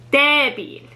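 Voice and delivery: "débil" with DÉ stressed and held, falling after; stress on first syllable. Shown as a demonstration. Teacher pointing at the accent mark, stress on DÉ in "débil". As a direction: lean on the E of "débil". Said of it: "stress is on the first syllable".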